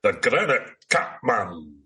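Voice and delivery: slow, drawn-out hammy announcer voice; mock-dramatic announcer. Co-host grandly echoing the creature's name, mock-dramatic. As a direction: intone it like a monster-film title.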